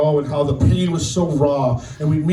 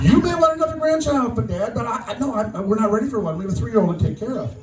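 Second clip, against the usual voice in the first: retold protest; 6 semitones higher, faster than usual. Daughter protesting to dad, retold.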